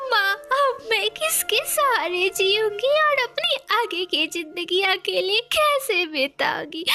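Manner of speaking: high, wailing daughter; weeping bitterly. Acted. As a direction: lament to your mother through sobs. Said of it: "फूट फूट कर रोने लगती है". (फूट-फूट कर रोती बेटी)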